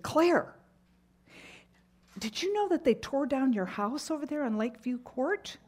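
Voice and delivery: high, loud call across the hall; friend calling out. Retold or imitated. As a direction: hail her from down the hall with news. Friend calls out news in hallway.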